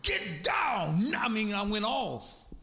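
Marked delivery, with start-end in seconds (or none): 0.0-1.1 slow shouted command; slow shouted go away; ordering her off. Retold or imitated. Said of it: "told her to scat".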